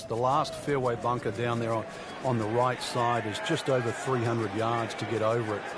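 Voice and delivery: normal talking voice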